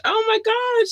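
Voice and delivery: can't contain the joy; high-pitched oh my gosh; thrilled squeal. Real, not acted. Gushing over Brussels listeners.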